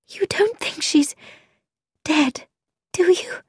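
breathless, frightened whisper; shaky whisper. A narrator's reading. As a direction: whisper it, voice trembling with fear. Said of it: "whispered Frankie in a shaky voice".

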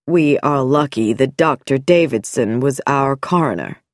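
a calm doctor hiding something; sinister doctor. Acted. Dr Nicholson voiced.

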